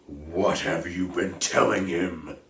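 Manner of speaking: an angry centaur confronting his kin; growling demand. Acted. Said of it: "growled Bane".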